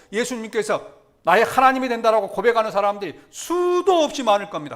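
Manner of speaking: high, loud, emphatic sermon delivery; full-force preaching. Real, not acted. Preacher declares miracles still happen today, impassioned full-force preaching. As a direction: drive every phrase home to the congregation.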